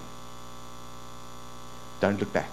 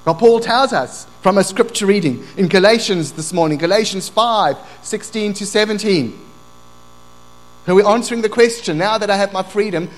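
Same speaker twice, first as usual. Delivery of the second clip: loud, raised preaching voice; impassioned preaching; 6 semitones higher, 7 dB louder than usual. Real, not acted. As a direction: drive the scripture home to the congregation.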